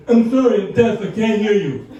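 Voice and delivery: loud, put-on deaf man's speech; pretending to be deaf. Passenger fends off chatty old man, faking deafness loudly. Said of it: "just pretending like I'm deaf".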